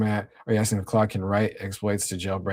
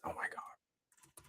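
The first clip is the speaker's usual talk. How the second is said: 1 exasperated whisper; 22 dB quieter, much slower than usual. Real, not acted. Friend request went unanswered.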